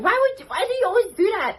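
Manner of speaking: fast, high mock-puzzled question; puzzled younger self. Student asking the bartender why he crosses his arms, high and quick. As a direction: squeak it out like your baffled student self. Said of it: "And I was like".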